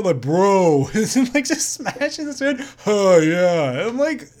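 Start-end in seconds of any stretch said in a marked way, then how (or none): 0.2-0.9 lightly voiced frat bro "Bro"; a hint of a frat boy. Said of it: "He does a voice of a frat". 2.9-3.9 frat bro. Retold or imitated. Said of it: "He does a voice of a frat".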